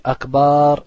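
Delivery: Akbar with a long added a, Akbaar, slow and loud; wrong added alif. Shown as a demonstration. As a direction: draw out the a of Akbar to show the error. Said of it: "tu rajoutes un alif".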